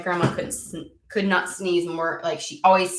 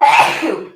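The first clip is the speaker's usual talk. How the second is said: a loud real sneeze then a high okay; 7 semitones higher, 12 dB louder than usual. Real, not acted. A sudden sneeze mid-sentence while talking to viewers, loud. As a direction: sneezes hard.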